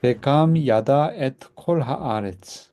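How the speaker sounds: slow, deliberate Hebrew recitation; teacher reading the verse slowly for repetition; slow model reading. Shown as a demonstration. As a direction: say each word slowly to be copied. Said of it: "class repeats".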